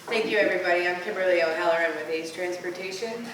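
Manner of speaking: ordinary talk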